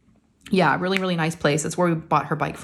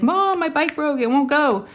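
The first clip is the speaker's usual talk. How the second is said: put-on high kid voice; 9 semitones higher than usual. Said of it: "she's like".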